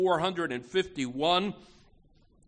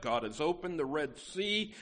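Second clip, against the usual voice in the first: ordinary talk, nothing marked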